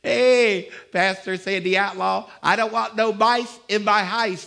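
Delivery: loud, high southern-style call; southern friends' voice. Retold or imitated. Imitating southern covenant friends calling to the pastor, loud. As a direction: belt it like his friends from down south. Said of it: "That's how they talk".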